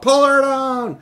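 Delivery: angry crowd roar. A narrator's reading. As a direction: roar it like a furious mob. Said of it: "an angry roar from the crowd".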